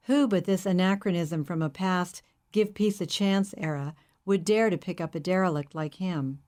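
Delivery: ordinary delivery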